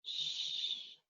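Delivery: soft hissed sh, no voice; just air, sh; sh sound. Shown as a demonstration. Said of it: "that sound is".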